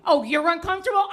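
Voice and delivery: high, fast, laughing mock of the doctor. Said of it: "mocking doctor".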